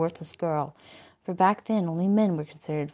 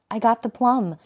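louder, higher call across the room; announced loudly; 5 semitones higher, 8 dB louder than usual. A narrator's reading. Phantom calling his prize across the room, loud. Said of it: "the red phantom announced from across the room".

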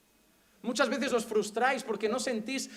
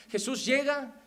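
usual speaking voice

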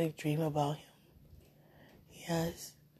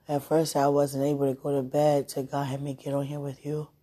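usual speaking voice

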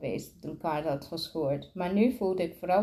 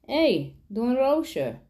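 higher, mildly raised call; a light, pitched-up hail; 5 semitones higher, 3 dB louder, slower than usual. A narrator's reading. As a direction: call out a playful nickname as a greeting. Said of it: "riep hij".

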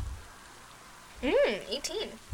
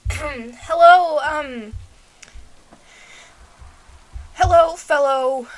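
loud, drawn-out greeting; addressing a crocodile; 2 semitones higher, 12 dB louder, much slower than usual. Acted. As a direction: hail a wary beast loudly and slowly.

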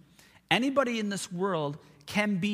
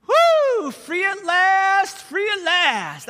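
much higher, loud, slow shout of freedom; joyful shout; 11 semitones higher, 10 dB louder, much slower than usual. Retold or imitated. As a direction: yell it while jumping for joy. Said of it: "I started jumping up and down".

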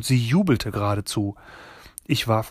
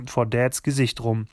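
usual speaking voice

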